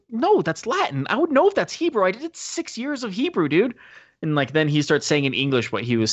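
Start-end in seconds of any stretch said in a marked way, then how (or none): 0.1-3.7 high, hammed-up know-it-all voice; a friend insists it is Latin, in a high smug mock voice. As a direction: correct him smugly. Voicing a friend.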